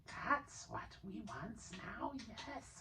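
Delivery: high, soft, slow creature voice; soft high creature longing; longing Gollum. A narrator's reading. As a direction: crave the ring.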